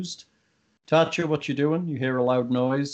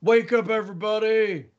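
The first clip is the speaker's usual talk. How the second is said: acted yell; 8 semitones higher than usual. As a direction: call out to rouse sleepers, without full volume. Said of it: "What are you gonna yell?".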